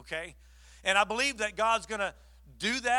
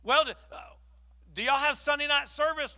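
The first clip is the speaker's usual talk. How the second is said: higher, louder hopeful question; eager caller; 8 semitones higher, 4 dB louder than usual. Retold or imitated. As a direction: Linda asking about services, higher and hopeful. Linda voiced asking eagerly.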